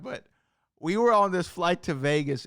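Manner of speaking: ordinary talk, nothing marked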